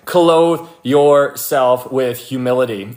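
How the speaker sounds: "clothe yourself with humility" drawn out slowly, word by word; emphatic. Real, not acted. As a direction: stretch "clothe" long and weighty. Preacher driving home key instruction.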